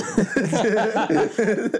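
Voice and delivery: bursting into high laughter. Real, not acted. Speaker cracking up while retelling the smoke scare. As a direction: laugh hard at the absurdity. Laughing about expecting death.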